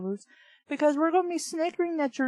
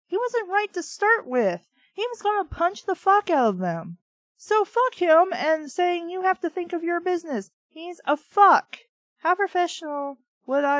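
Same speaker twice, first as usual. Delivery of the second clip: higher, louder heated rant; angry rant; 5 semitones higher, 4 dB louder than usual. Real, not acted. Reader ranting at the hero of the novel, heated. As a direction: vent about the hero.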